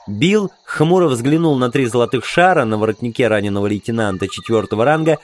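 usual speaking voice

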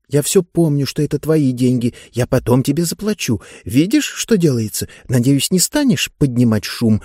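ordinary delivery, nothing marked

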